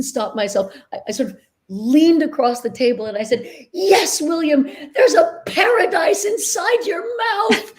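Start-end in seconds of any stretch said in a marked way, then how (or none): 3.7-7.7 high, gushing, heartfelt praise; mean every word, almost moved to tears; dorky and earnest. Retold or imitated. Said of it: "dorky and earnest".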